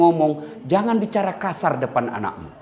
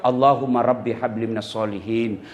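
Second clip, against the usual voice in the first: doa lembut untuk anak; 9 semitones lower than usual. Shown as a demonstration. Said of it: "Kita suap".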